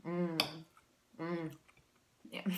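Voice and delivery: chewing while humming; eating pancake; mouth full. Real, not acted. Seen 1: She bites the food just before and chews with closed lips while humming.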